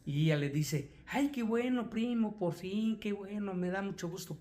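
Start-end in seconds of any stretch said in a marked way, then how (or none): 1.1-4.4 put-on gladness. Cousin hears of the wedding, feigning gladness. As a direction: gush congratulations in a raised pitch. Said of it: "Pero realmente dice, para mí fue un golpe".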